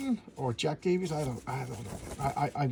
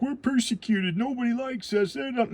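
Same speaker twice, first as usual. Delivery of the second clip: higher, mock self-pitying whine; mock whiny mutants; 9 semitones higher than usual. Mocking modern X-Men comics, a pitched-up self-pitying whine. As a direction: parody the moping heroes, pitched up. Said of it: "Not once were they like".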